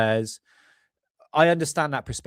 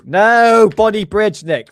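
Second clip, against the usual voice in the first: flustered correction; 9 semitones higher, 11 dB louder, slower than usual. Real, not acted. Flustered self-correction mid-stream.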